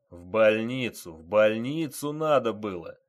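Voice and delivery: loud, insistent reprimand; a major loudly dressing down his men; angry scolding. Acted. Major scolds officers in the yard.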